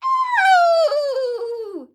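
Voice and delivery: high, loud wailing "ow"; long pained yell. A narrator's reading. Grandma reacting to the medicine, a long high yell. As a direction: howl it out in shock, long and high. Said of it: "Grandma yelled".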